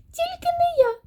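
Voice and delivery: very high mouse squeak; piercingly high little-creature voice; mouse crying out. A narrator's reading. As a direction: squeal the refusal like a tiny mouse. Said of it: "закричав Верть".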